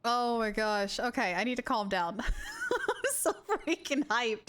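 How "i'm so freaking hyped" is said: hyped. Real, not acted. Streamer unable to calm down, laughing and hyped. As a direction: laugh through it, stretched out and giddy. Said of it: "I am so hyped".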